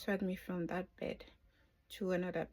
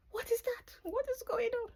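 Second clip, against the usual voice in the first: much higher, startled and confused; alarmed question. Retold or imitated. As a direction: panic mid-surgery, voice jumping up. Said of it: "I was like".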